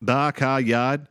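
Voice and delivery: slow, stretched Boston "Ba ka yad"; Boston accent. Retold or imitated. Topping a friend's joke with a slow Boston "Ba ka yad". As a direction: drawl "Ba ka yad" with no r's, Boston-style. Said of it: "talking like a Boston accent".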